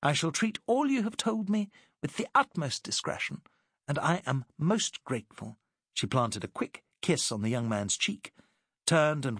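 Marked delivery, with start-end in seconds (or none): 0.0-5.6 higher, lightly sweet ladylike voice; Alice, gracious lady. Acted. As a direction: thank him graciously as Alice. Alice's grateful reply to lieutenant.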